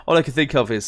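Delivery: normal talking voice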